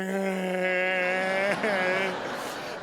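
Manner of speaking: long drawn-out fake sob; mock crying wail. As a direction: wail like you're bawling at a movie. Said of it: "I cry at movies".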